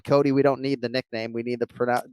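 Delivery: ordinary talk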